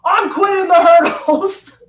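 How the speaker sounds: high, loud, slow shout of quitting; frustrated outburst. Retold or imitated. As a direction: fling it out like a fed-up athlete. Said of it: "I said".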